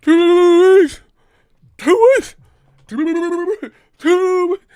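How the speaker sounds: very high, loud, glitching repeat; malfunctioning robot woman. Retold or imitated. Mimicking the Total Recall disguise glitching, very high loud. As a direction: stutter like a broken robot. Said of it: "that bitch from Total Recall".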